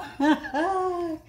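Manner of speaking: a high, breathy burst of laughter; delighted laugh. Real, not acted. Opening a viewer's gift, a delighted high laugh.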